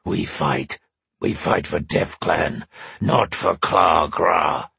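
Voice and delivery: deep, slow, rough-as-gravel barbarian voice; rough gravelly barbarian. A narrator's reading. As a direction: grind each word out like gravel. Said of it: "the words as rough as gravel".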